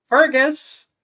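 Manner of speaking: high, loud call across the room. Acted. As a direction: call his name sharply. Professor calling out student.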